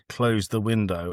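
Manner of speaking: ordinary delivery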